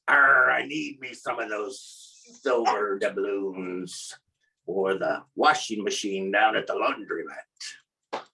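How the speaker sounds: low, slow pirate growl; deep pirate at the counter. Retold or imitated. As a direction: drawl it low like a pirate, starting on "Arr". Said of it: "in the pirate voice".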